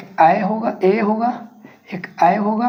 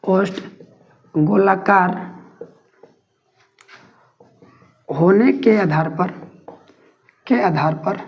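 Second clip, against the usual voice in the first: slow, phrase-by-phrase dictation with repeats; teacher dictating notes, pausing between chunks; 4 semitones lower, slower than usual. Acted. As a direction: dictate each phrase for students to write.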